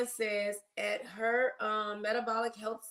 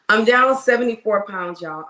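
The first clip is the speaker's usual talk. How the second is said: proud announcement; 11 dB louder than usual. Real, not acted. Proud weight loss announcement.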